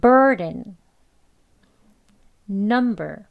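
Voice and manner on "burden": burden with bur said louder; stress on bur. Shown as a demonstration. Teacher repeats burden with stress up front. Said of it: "앞에 강세가 있고".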